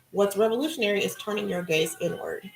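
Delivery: ordinary delivery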